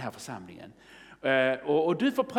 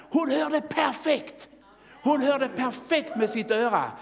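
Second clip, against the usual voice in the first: high, drawn-out exclamation; exultant proclamation; 9 semitones higher than usual. Real, not acted. Preacher telling congregation of a healed ear, exultant proclamation. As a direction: proclaim the miracle with a raised voice.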